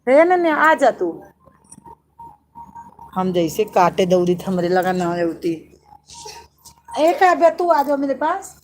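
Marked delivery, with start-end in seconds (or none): 0.1-1.1 raised, higher call across the room. Real, not acted. Mother calls her child to come, loud call. 7.0-8.5 high, loud call of a name. Real, not acted. Mother calls Kavya to come over, loud call.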